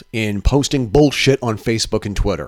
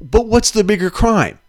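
incredulous; 9 semitones higher than usual. Real, not acted. Incredulous rhetorical questions.

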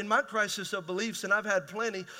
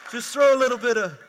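laughing while preaching; 6 dB louder than usual. Real, not acted. Joking about marriage.

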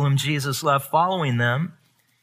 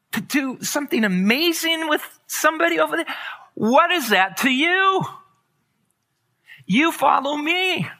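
the Lord speaking; 13 semitones higher than usual. Retold or imitated. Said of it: "the Lord was saying".

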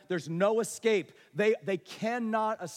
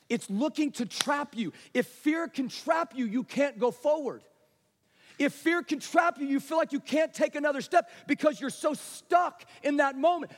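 high, driving, emphatic sermon; urgent pulpit crescendo, sustained; forceful preaching; 7 semitones higher, 6 dB louder than usual. Real, not acted. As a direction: hammer each phrase at the congregation.

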